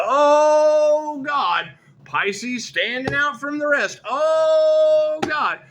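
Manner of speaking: high, long-held wails of "oh"; loud exclaiming. Real, not acted. Reader exclaiming over Pisces standing out, loud cry.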